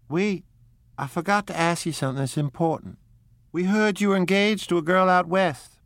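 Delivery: high, commanding call after him; peremptory call. Acted. Daisy calls Nick back as he drives off, peremptory. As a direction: stop him with a sharp command. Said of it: "Daisy peremptorily called".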